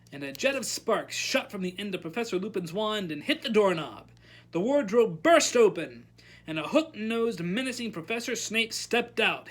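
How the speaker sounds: ordinary delivery, nothing marked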